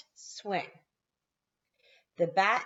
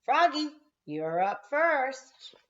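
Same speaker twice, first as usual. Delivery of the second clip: higher, slow call; 8 semitones higher, 4 dB louder, slower than usual. A narrator's reading. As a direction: call the batter up. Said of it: "she yelled".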